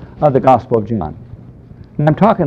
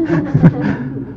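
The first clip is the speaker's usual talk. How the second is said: a short high breathy laugh; amused laugh; 11 semitones higher than usual. Real, not acted. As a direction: laugh at the irony of it. Laughs at his own joke.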